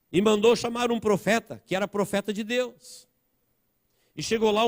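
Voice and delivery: high, urgent preacher's retelling; impassioned preaching. Real, not acted. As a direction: tell the Bible story at sermon pitch. Preaches the story forcefully.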